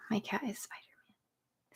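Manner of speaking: hushed whisper to the camera; soft aside in a whisper. Real, not acted. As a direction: whisper it like a secret.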